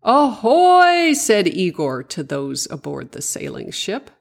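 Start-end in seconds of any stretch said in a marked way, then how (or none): 0.0-1.1 high, loud drawn-out ship's hail; calling out. A narrator's reading. Igor hails those aboard the sailing ship, calling out. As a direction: hail the crew across the water in a high voice.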